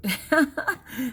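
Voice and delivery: sudden loud, high laugh. Real, not acted. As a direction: burst out laughing after a grim remark. Laughs after "we're all screwed.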